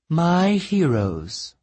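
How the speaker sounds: slow, high, regal goddess voice; commanding warrior goddess. A narrator's reading. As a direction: greet them slowly like a queen. Goddess greets the heroes.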